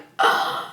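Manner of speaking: mock gasp of a scandalized crowd; shocked gasp. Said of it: "everybody's like".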